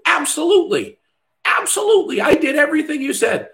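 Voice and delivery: very high, chirpy mock client; high singsong mock assurance; eager client. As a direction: play the overeager student insisting. Voicing the client's reply.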